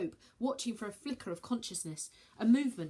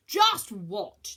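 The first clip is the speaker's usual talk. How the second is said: loud, sharp demand; 10 dB louder than usual. Acted. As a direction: press her for an answer. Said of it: "demanded Sagittarius".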